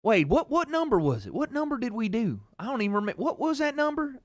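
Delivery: high-pitched, coy dad voice; imitated dad. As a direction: fish for the answer as dad would. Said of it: "but he'll go".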